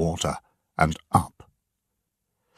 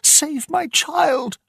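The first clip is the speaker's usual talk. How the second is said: slow, high pleading shriek; much higher drawn-out scream for help; desperate scream; slower than usual. A narrator's reading. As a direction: beg for the child's life. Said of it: "the woman screamed".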